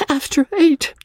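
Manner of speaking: high, miserable repeat; young wife despairing over the late hour; wretched echo. A narrator's reading. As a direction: echo it near tears. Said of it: "she echoed wretchedly".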